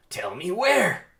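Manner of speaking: high, loud and slow interrogation yell; shouted demand. Acted. Barry threatening Hector, loud slow shout. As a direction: bellow the demand right in his face.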